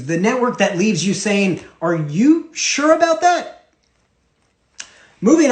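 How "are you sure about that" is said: high, drawn-out sceptical question; mock disbelief. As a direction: act out a doubtful reader, pitched way up and slow. Voices the viewer's doubting question.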